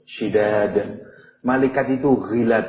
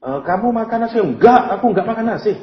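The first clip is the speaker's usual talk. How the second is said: casual denial demo; 11 semitones higher, 4 dB louder than usual. Shown as a demonstration. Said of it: "Apa to refute?".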